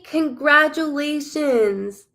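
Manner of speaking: host repeats it to the winner, slowly; slow congratulations. Real, not acted. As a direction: stretch every syllable out slowly.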